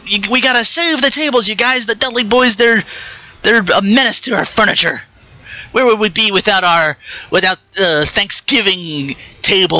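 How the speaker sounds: high, whiny plea for the poor tables; mock pleading wrestler. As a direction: beg them to spare the furniture, hammed up.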